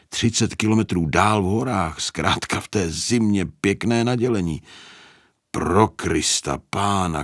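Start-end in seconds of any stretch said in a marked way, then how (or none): none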